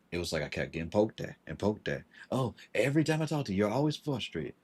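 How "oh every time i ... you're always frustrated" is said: much higher, quick, sing-song mimic of her; mimicking her complaint, high and mocking; mocking her whine. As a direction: parody her nagging in a high put-on voice. Mocking the other woman's complaint.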